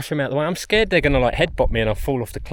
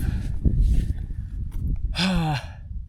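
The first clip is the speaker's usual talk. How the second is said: exhausted sigh; 4 semitones higher than usual. Real, not acted. Exhausted hiker reaches summit.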